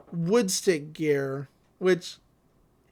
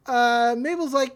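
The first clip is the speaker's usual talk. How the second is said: usual speaking voice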